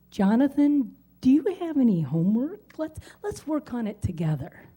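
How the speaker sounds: ordinary delivery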